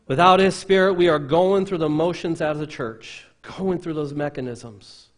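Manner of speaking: loud preaching, starting high and easing down; full-force preaching. Real, not acted. Preacher warning about going through the motions, full-force preaching. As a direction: hit the opening hard, as a warning.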